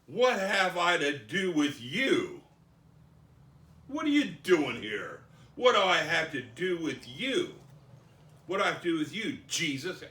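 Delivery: possessed man's loud challenge, hammed up; crying out loudly. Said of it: "He cried out with a loud voice".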